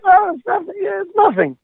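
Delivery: embarrassed songwriter hiding his tune; shy self. Retold or imitated. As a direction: play it down bashfully in a high voice. Retelling his shy reply.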